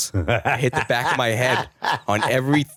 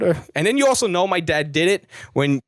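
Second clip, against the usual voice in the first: usual speaking voice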